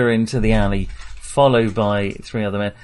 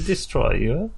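put-on Arabic accent; 4 semitones higher than usual. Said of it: "Which is why I was doing an Arabic accent".

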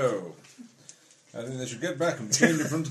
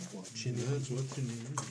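ordinary talk, nothing marked

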